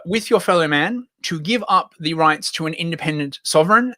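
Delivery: ordinary talk, nothing marked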